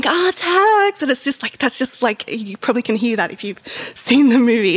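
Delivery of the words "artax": high-pitched mock cry, held back in volume; drawn-out, high retold yell; mock scream. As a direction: imitate a desperate scream without the loudness. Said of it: "he's, like, screaming".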